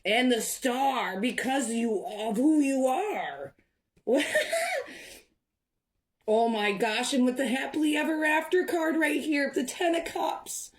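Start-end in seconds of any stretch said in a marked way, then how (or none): 4.1-5.0 a high, bright burst of real laughter; delighted laugh. Real, not acted. Tarot reader laughing with joy at the spread, high delighted laugh.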